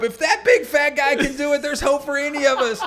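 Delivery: high, loud, laughing shout; excited shout. Retold or imitated. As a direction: shout the hope aloud. Imagined lady's thought.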